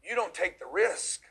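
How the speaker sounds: normal talking voice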